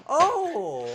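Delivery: high startled oh; surprised oh. Real, not acted. Streamer catching the joke, high oh.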